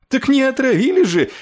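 high, loud outburst of helpless fury; яростный выкрик. A narrator's reading. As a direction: cry it out in exasperation. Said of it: "лютея от беспомощности, выкрикнул". (беспомощно-злой выкрик)

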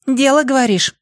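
put-on high girl's voice, agreeing; Нори. A narrator's reading. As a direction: agree in a high-pitched teen girl voice. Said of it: "кивнула Нори". (высокий девчачий голос, согласие)

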